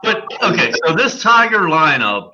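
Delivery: ordinary delivery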